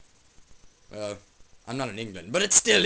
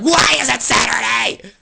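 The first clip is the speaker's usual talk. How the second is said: much higher and louder, exasperated; loud high demand; 7 semitones higher, 12 dB louder than usual. Acted. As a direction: shout the question in disbelief. Dude asks, acted quote.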